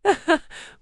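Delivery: bright high burst of laughter. Real, not acted. Class laughs at robot joke.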